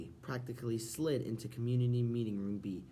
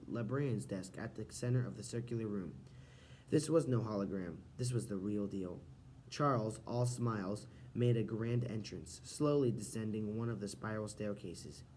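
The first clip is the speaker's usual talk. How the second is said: ordinary delivery, nothing marked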